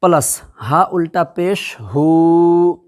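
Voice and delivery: slow, drawn recitation of letters; spelling out syllables. Shown as a demonstration. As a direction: sound out each letter slowly like a Quran teacher. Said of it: "खैर".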